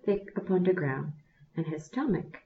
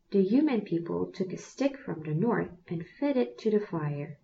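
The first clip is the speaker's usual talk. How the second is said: plain reading voice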